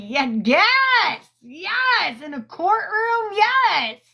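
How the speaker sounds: high, loud, delighted yes; gleeful cheering. Retold or imitated. Cheering him on while watching the trial, high and gleeful. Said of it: "I was just like".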